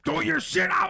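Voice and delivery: high, frantic yelled order; acted-out high-pitched alarm; panicked shout. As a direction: shout it as if a raid is coming.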